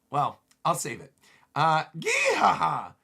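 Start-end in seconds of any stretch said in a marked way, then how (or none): 2.0-2.9 slow, loud, acted evil laugh; villain's cackle. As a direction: cackle in gloating triumph. Voices villain's gloating laugh.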